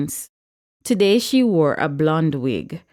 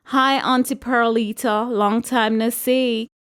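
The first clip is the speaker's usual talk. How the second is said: higher, sing-song mock sweetness; mocking greeting; 6 semitones higher than usual. A narrator's reading. Regina taunting Aunt Perlita, mocking and sing-song. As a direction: tease her with fake friendliness. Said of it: "she said mockingly".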